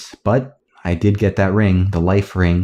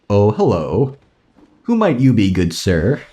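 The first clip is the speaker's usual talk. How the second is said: polite door-answerer. Acted. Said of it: "imagine that with a nice accent".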